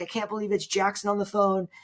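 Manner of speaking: high-pitched mock amazement; freaking out. Callers reaching Jackson on the phone, freaking out. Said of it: "They're freaking out".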